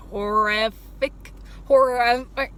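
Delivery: "horrific" drawn out, its middle sounds slurred away; tongue-tied horrific. Real, not acted. Vlogger fumbling a word about the buffet, tongue-tied "horrific". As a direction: stumble over "horrific", swallowing the consonants. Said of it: "I don't know why it came out so".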